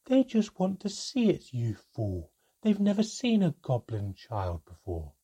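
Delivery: a hint of melodic scorn; Manka amused. A narrator's reading. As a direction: set the fool straight with a smile. Manka mocks Handan's fear.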